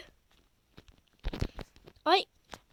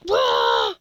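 shocked shout; 7 dB louder than usual. Real, not acted. Sudden shock in game.

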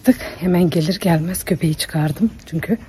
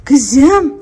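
higher, louder coaxing call to the dog; 6 semitones higher, 4 dB louder than usual. Real, not acted. To the crying dog, a higher coaxing call. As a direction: call her in sweetly.

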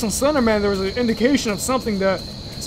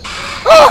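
high, very loud shriek; startled scream; 9 dB louder than usual. Real, not acted. Player caught by the monster, startled scream. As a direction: scream in sudden fright. Jump scare in game.

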